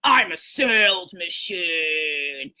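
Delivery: low, very slow, each word planted; deliberate, low, drawn-out affirmation; confident sales tone. Shown as a demonstration. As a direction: state it like a sales machine that can't lose. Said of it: "do it in that tone like that".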